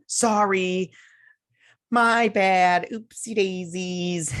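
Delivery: high, loud fake-sweet sorry, slowing on oopsie daisies; mock innocent apology. Uhura fake-apologizing after slicing the enemy ship, high sugary mock sorry. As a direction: play the cute accident after blasting a ship in half. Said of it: "She's like".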